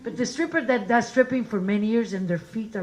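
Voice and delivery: ordinary talk, nothing marked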